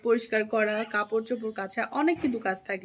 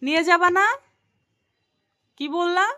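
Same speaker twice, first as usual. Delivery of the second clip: higher and louder, insistent questions; loud pleading nag; 6 semitones higher, 7 dB louder than usual. Real, not acted. Wife pestering sleepy husband to go out, loud nag. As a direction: keep pushing until he answers.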